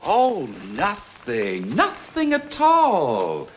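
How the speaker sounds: drawn-out sarcastic swoop, climbing high; bitter sarcasm. Acted. Coach answering what else she owes him, bitterly sarcastic. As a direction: stretch it slowly with wounded sarcasm.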